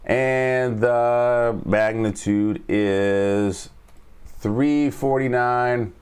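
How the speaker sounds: slow, deliberate price read-out. Real, not acted. Teacher slowing down so viewers catch the price levels. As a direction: spell the numbers out slowly. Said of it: "Let me slow down".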